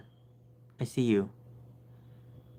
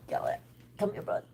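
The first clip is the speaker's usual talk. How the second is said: breathy hush, much softer; whispered coaxing; 6 semitones higher, 11 dB quieter than usual. Real, not acted. Owner whispering to coax her cat over.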